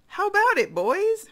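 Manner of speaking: loud, high-pitched call to the gang; big rousing shout; crocodile calling out. Acted. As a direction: holler it up high to your pals. Crocodile calls his fellows.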